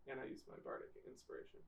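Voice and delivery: whispering. Real, not acted. Quietly declaring a game move.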